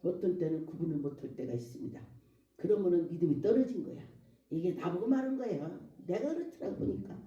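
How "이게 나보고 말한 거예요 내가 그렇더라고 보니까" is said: usual speaking voice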